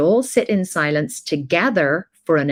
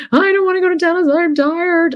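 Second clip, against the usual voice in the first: put-on much higher, louder child's whine; whiny child; 5 dB louder than usual. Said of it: "And he said".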